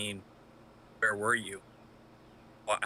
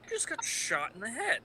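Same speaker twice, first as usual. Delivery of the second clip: stunned disbelief; 6 semitones higher than usual. Acted. Shock after the bullet hits.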